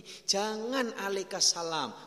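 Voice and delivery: slow, drawn out, "Jangan" stressed; slow teaching. Shown as a demonstration. Preacher teaching congregation the greeting rule. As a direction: spell the rule out slowly. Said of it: "Rasul mengajari kita kayak gini".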